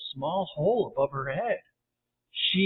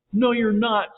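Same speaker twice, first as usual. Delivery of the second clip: mild sobbing protest, raised in pitch; sobbed; 4 semitones higher, 4 dB louder than usual. A narrator's reading. Sally to James from below, understated sob. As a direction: protest with a touch of tears. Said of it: "Sally sobbed".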